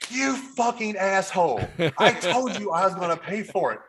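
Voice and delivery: fake-furious outburst at the table; hammed-up angry yell; mock outrage. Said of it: "She says".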